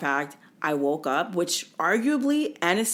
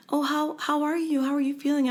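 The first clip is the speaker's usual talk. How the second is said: high sweet nurse voice; 8 semitones higher than usual. Retold or imitated. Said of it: "she's like".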